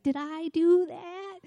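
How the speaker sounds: high, drawn-out sitcom catchphrase voice; Urkel. Retold or imitated. Preacher quoting a sitcom character's catchphrase, goofy impression. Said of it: "there was a character named Urkel".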